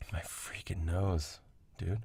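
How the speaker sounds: breathy whispered grumble to yourself; slow aside under the breath, annoyed; whispered complaint about the nose. Real, not acted. Nose trouble, muttered to self.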